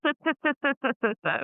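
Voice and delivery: hissing like a little snake; playful snake hiss; drawn-out s like a snake. Shown as a demonstration. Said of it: "little silly snake going".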